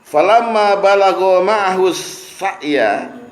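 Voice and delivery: slow, drawn-out chanted Arabic; Quran recitation. Acted. Preacher reciting the verse to the congregation, slow chant. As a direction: intone the verse slowly like tilawah.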